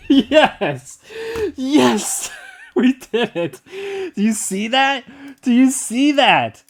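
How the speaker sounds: high, loud cries of victory with laughter; real ecstatic triumph; jubilant yelling. Real, not acted. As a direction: shout it like a winning goal. Finds the guessed number is correct.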